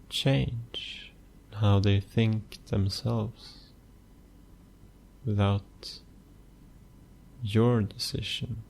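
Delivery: soft, unhurried meditation voice with long pauses; hushed, even delivery, letting phrase ends fade; guided meditation. Acted. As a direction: guide the listener gently into stillness, phrase by phrase.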